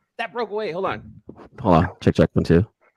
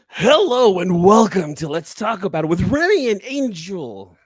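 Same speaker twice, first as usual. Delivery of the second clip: announcer intro; 7 semitones higher, 7 dB louder than usual. Shown as a demonstration. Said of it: "I need direction".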